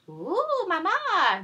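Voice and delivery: very high, loud squeal; spoiled stepsisters squealing over a party; twittering daughters. Acted. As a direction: coo excitedly like silly girls. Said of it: "Valentina and Dulce twittered".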